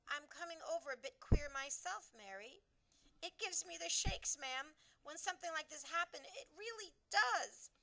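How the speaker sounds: a queen rattled to the core; shaken Queen. A narrator's reading. Queen admitting to her maid she feels shaken.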